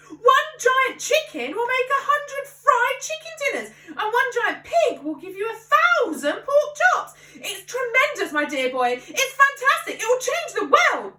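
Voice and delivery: high, loud and slow, arms waving; a giddy father carried away; excited. A narrator's reading. Father dreams of giant animals to his son, high and loud. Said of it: "cried Mister Cranky, waving his arms".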